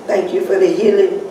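ordinary delivery

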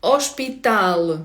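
"Ошпітал" slowly as osh-pee-TAL; slow Portuguese "Ошпітал", no initial h; stress on last syllable. Shown as a demonstration. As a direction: draw out "Ошпітал", landing on TAL. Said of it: "також до цього правила".

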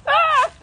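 short, high, loud shriek; sudden high-pitched yelp; startled scream. Real, not acted. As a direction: shriek out in real fright. Startled by flies on dead bird.

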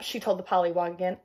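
plain reading voice

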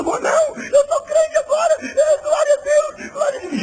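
high, loud tearful wail; crying man. Retold or imitated. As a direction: imitate a sobbing new convert praising God. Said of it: "E ele chorando".

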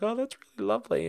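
high, quick mock-feminine voice; quick high-voiced impression of someone's wife; imitated wife. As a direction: pitch it way up as the delighted wife. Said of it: "And his wife's like".

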